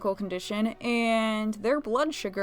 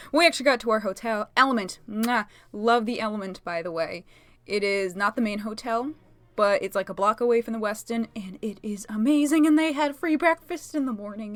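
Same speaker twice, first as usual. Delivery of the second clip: normal talking voice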